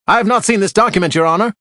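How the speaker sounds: high, loud protest; objecting loudly. A narrator's reading. Lawyer objecting to the judge, loud and high. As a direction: shoot up and object.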